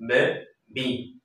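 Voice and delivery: বেবি in two separate beats, বে-বি; teacher demonstrating the two parts of বেবি; syllable by syllable. Shown as a demonstration. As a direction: sound out বেবি with a gap inside. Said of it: "তোমরা যদি এভাবে উচ্চারণ করো".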